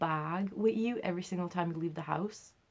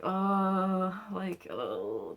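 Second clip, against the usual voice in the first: retold embarrassed groan; much slower than usual. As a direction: groan in mock mortification.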